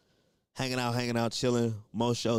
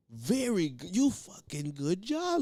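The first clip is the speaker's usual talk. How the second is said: effusive praise; 5 semitones higher, slower than usual. Retold or imitated. Mustafa's effusive praise, retold.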